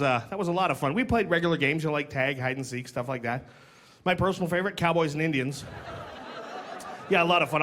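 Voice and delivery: normal talking voice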